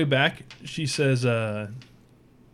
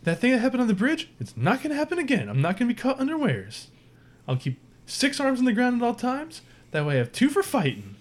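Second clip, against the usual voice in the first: high, eager alien voice; high spider woman reassuring the captain; Kritka's voice; 10 semitones higher than usual. Acted. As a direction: pitch up, confident and keen. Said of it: "She says".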